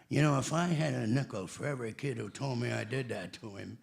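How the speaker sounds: a hint of Tigger in ordinary speech; Paul Winchell. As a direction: imitate an old cartoon voice actor just talking. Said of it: "'cause he sounded like s-, halfway Tigger just when he talked".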